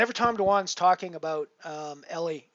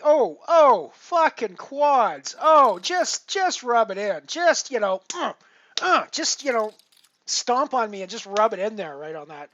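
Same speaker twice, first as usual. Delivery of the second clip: loud, high wail of disbelief; exasperated outburst; 5 semitones higher, 9 dB louder, slower than usual. Real, not acted. Streamer reacting to quads rubbed in on the river, exasperated. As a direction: cry out at the bad beat, punching each phrase.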